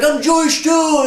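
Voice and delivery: high, loud and overjoyed; excited shout. Retold or imitated. Stranger hugging the narrator, shouting with joy. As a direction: yell it while hugging him. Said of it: "And he's like".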